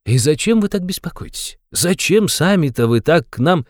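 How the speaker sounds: high, teasing questions ending in a giggle; gleeful, high-pitched needling; sly, merry interrogator. A narrator's reading. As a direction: taunt him with playful questions. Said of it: "лукавым и нисколько не встревоженным видом".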